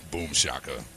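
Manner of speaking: usual speaking voice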